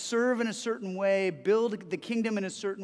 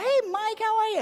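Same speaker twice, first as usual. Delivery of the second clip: put-on very high, loud boyish squeak; squeaky kid voice; 14 semitones higher, 5 dB louder than usual. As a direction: chirp it like an eager little kid. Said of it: "he came like".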